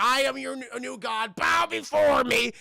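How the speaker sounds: hammed-up almighty ruler; godlike proclamation. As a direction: command your worshippers, drawing it out. Imagined hero addresses his worshippers.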